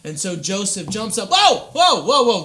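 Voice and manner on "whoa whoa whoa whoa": startled, loud and high whoa; a man jolted out of a dream, startled; startled waking. Acted. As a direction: jolt awake in shock.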